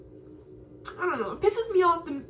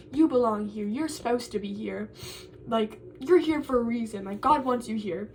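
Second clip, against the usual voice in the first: low-pitched, lightly mocking reassurance; mimicked school pep talk; 6 semitones lower than usual. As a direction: lightly imitate an official's welcoming assurances. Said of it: "They talk about, like".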